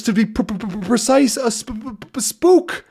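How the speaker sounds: high, stuttering in fright; frightened stammer. Acted. Terrified sailor stammering to Tintin about a spook. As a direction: stammer it out, scared stiff.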